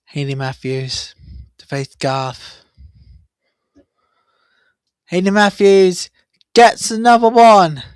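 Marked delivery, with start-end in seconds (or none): 5.1-7.8 loud, high-pitched cricket boundary call; excited play-by-play. Real, not acted. Commentator calls a boundary for Hayley Matthews, excited. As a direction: call the four with a real lift in pitch and volume.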